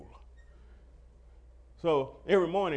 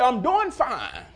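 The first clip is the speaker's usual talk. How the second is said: defiant; 8 semitones higher, 9 dB louder, much slower than usual. Retold or imitated. Defiant answer at Walgreens.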